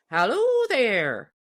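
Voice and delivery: high, loud, slow hail across the clearing; piping call. Acted. Deacon hailing Paul's camp, high piping call. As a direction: call out from far off. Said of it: "piped the deacon".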